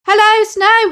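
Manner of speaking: high, loud happy greeting; joyful shout. Acted. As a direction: shout hello from the water. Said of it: "Henry shouted".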